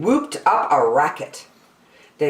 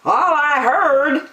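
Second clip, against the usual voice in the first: higher, slow and snappy; bossy kangaroo snapping back; snappish kangaroo; 9 semitones higher, 3 dB louder than usual. Acted. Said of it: "snapped the big kangaroo".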